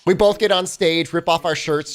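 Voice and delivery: ordinary talk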